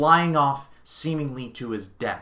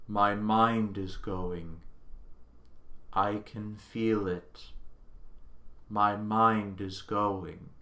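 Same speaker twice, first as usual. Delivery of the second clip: slow, soft, winding-down machine voice; dying computer voice; 4 semitones lower, 6 dB quieter, slower than usual. Retold or imitated. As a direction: drag each word like power fading. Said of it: "he's like".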